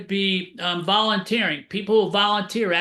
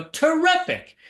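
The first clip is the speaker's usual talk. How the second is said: enthusiastic reply; 9 semitones higher, 3 dB louder, slower than usual. Retold or imitated. Upbeat answer to grocery clerk.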